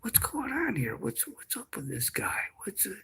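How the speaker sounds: very soft, high, conspiratorial whisper; barely audible mock-secretive questions; imitated whispering. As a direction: whisper it like the officer confiding next door. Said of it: "he started whispering".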